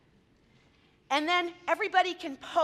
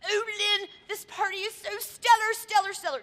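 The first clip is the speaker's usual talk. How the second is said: higher, gushing boss voice raving; gushing CEO; 7 semitones higher than usual. Retold or imitated. CEO raving to Lynn about the party, gushing. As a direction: lay on the delight, a bit hammed-up.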